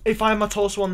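normal talking voice

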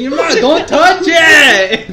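laughing shout. Real, not acted. Scolding a player, laughing.

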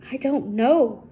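plain reading voice

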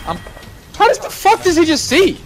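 panicking gamer. Real, not acted. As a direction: shout in shock, voice climbing. Seen through wall, panicking.

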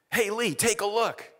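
high, bright call of the doctor. Retold or imitated. Doctor voiced in the story.

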